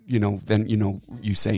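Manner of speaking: ordinary delivery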